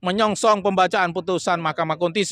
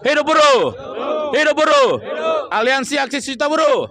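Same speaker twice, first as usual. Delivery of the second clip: fiery revolutionary speech; 4 semitones higher than usual. Real, not acted. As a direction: rouse the crowd with a battle cry. Rally closing chant to crowd.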